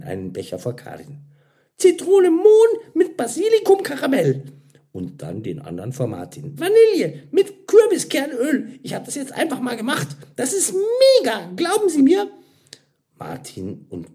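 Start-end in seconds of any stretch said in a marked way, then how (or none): 1.8-4.4 very high, loud flavour presentation; eager announcement. A narrator's reading. Mira proudly announces her creation. 6.6-12.3 very high, loud excited pitch; enthusiastic server. A narrator's reading. Mira enthusiastically sells her invention.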